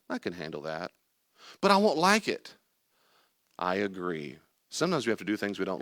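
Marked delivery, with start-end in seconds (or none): 0.1-0.9 low, soft, settled; calm self-talk. Shown as a demonstration. Speaker modelling self-control, low and soft. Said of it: "control our own self by saying". 1.6-2.5 high, loud whiny protest; protesting objector. Acted. Reluctant person objecting to the calm self-talk, high protest.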